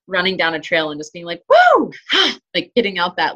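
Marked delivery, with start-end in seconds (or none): 1.5-1.9 loud, high-pitched "woo!"; excited whoop. Retold or imitated. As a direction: let out a trail runner's burst of excitement. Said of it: "like, excitement".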